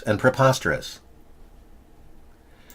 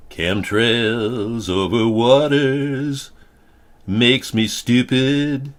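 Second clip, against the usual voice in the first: mock dumbed-down voice; much slower than usual. Dim voiced chemtrail victim.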